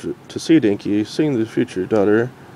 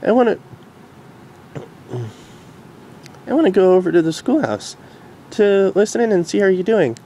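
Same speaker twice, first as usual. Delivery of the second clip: higher, gentle mare voice; reassuring Derpy; 9 semitones higher than usual. Acted. Derpy hugging Dinky, gently reassuring.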